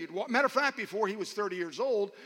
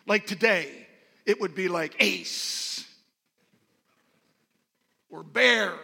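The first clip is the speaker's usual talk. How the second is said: loud, punchy preacher delivery; emphatic preaching; 8 dB louder, slower than usual. Real, not acted. Preacher telling congregation some names stand out, emphatic and loud.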